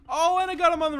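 fast, high, loud burst of triumph; excited shout. Real, not acted. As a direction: yell it out as you land the hit. Beating a boss in game.

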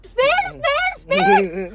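very high-pitched mimicked yell; mock shouting. Wife mimics husband yelling at her as she drives, high mock shouting. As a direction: imitate his frantic driving commands way up high. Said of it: "एवढ्या जोरात ओरडल्यावर".

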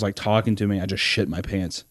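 normal talking voice

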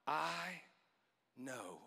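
hushed dramatic pause; fading hush. Acted. Dramatic fading of angel's words.

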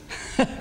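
quick breathy chuckle at himself; a small embarrassed laugh; short unvoiced laugh. Real, not acted. Laughs at own delivery.

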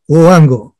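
loud prompt, called to the room; calling out. Shown as a demonstration. Club leader checks the audience's voices are out, calling out. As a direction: call the word out loudly.

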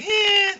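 high shrugging eh. Retold or imitated. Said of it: "at first I was like".

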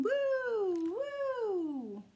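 high sung woo woo like a whistle; playful whistle for children; train whistle woo. Acted. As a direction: call it out like a train horn.

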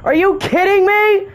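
shrieking, slow and outraged; furious high shout. Real, not acted. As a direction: scream in disbelief at the game. Dies in game, screams in frustration.

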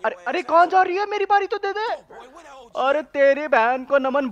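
very high, loud, pleading yell; frantic shout. Real, not acted. Gamer yelling at someone who leaves with his turn, frantic shout. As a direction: scream after them to stop.

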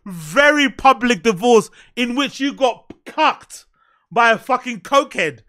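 ordinary talk

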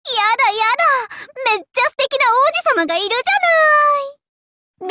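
high, thrilled gush. Acted. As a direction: swoon over the handsome prince. Gushing over handsome prince. (素敵な王子様を見てはしゃぐ高い声)

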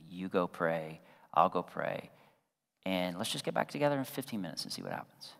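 low, soft conciliatory voice; gentle apology. Retold or imitated. Husband apologizing to wife mid-argument, soft and gentle.

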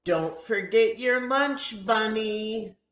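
put-on a teacher calling after the kids; high bright reminder; teacher reminding. A narrator's reading. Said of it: "Missus Millie reminds us".

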